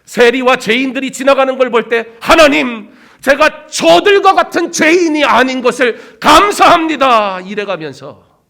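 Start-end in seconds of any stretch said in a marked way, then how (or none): 2.2-7.4 loud, high, slow self-righteous prayer; showy prayer. Retold or imitated. Pharisee thanking God he is not like sinners, loud and showy. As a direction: pray to be overheard.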